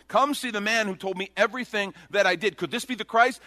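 high, eager retold call; excited announcement. Woman tells everybody in her village.

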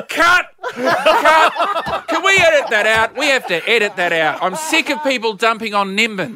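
high-pitched mock heckler's yell, easing slightly after the first cries; retold yell. Comic retelling a Nimbin local interrupting his filming, mock high yell. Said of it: "they just yelled out".

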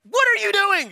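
high, loud exasperated cry; hammed-up frustration at a sleeping Jesus; irritated disciples. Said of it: "And they're so irritated".

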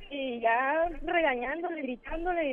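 normal talking voice

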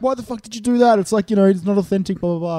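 mock outrage tapering into dismissive blahs; mock indignant. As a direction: protest like a baffled viewer, lightly exaggerated. Voicing viewers' complaints about casting.

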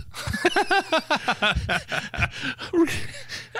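high helpless laughter. Real, not acted. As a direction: burst out laughing. Laughing at the sketch.

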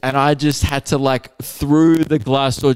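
ordinary talk

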